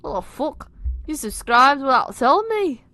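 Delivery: betrayed outrage. Acted. Nick confronting friend who subscribed, betrayed outrage. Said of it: "feeling betrayed".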